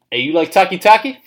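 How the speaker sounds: high, loud, breezy GM asking; mock John Dorsey. GM John Dorsey asking his scout about the pick, mock loud high voice. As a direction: play the GM casually pitching the pick, up high and loud. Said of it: "John Dorsey goes".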